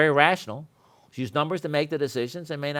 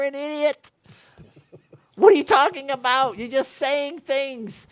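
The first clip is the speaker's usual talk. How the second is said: much higher, building in disbelief; rising disbelief at small talk; incredulous rant; 9 semitones higher than usual. Real, not acted. To the class about normal people, incredulous rising rant.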